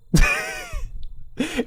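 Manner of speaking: high wheezing laugh; shrieking laugh; crying laugh. Real, not acted. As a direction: laugh till you cry. Laughing hard.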